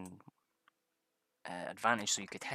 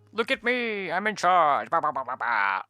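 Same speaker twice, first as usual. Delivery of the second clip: much higher, louder, pompous mock voice; mock bossy player; 6 semitones higher, 9 dB louder than usual. Imitating the Paladin player bossing everyone, pompous mock. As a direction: strut and blather like a bossy know-all. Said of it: "the way he was playing it".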